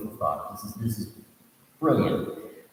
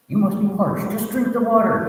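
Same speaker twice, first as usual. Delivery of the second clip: high, wheedling monster, louder; coaxing ogre voice; 6 dB louder than usual. Acted. Ogre tempting the thirsty monkeys to drink, high coaxing voice. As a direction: lure them to the water, sweetly sly.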